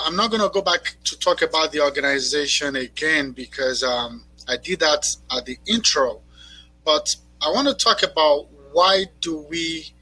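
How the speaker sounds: normal talking voice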